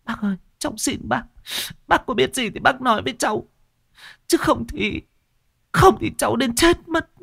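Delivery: very high, fast, frantic begging; cling to him and beg, rising to a cry; desperate pleading widow. A narrator's reading. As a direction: widow clutching old Ngô's arm, frantic and high. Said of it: "bấu chặt lấy tay của bác Ngô".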